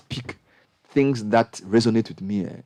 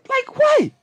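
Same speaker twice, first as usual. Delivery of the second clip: exasperated cry; 3 dB louder than usual. Real, not acted. Exasperated rhetorical question.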